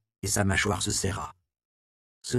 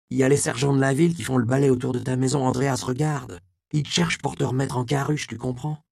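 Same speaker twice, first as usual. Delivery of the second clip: higher, louder, hurried woman's voice; urgent warning; 5 semitones higher, 5 dB louder than usual. Acted. Magdala urgently warns Andreas the sergeants hunt him, hurried. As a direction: rush the warning out in a panic.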